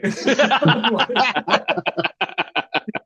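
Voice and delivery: loud high burst of laughter. Real, not acted.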